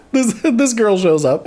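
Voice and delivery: slow, still giggling as the words come out; laughing through it. Real, not acted. Retelling the scene to viewers, still laughing. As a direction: try again while you can't stop laughing.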